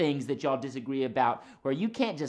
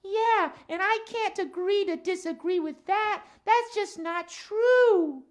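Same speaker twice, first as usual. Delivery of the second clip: higher-pitched puppet voice, earnest complaint; lilting cat character, key words drawn out; Max the cat; 4 dB louder, slower than usual. Acted. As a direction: play a cat puppet airing a grievance.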